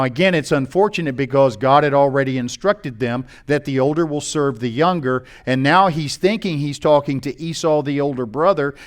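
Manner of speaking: normal talking voice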